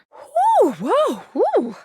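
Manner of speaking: high, swooping whoops; high whooping exhale. Acted. Reacting aloud to the player, swooping high whoops. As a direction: let out whoops that leap up and drop. Wordless exclamations of relief or amazement.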